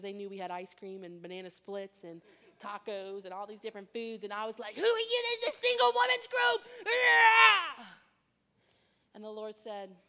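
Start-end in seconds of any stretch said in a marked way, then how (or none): 4.7-6.6 high, loud, indignant cry; mock exasperated. Preacher mimicking her younger self at late-night visitors, high indignant yell. 6.8-7.8 high yelled yeah; mock shout. Preacher hamming up her reaction, loud high shout.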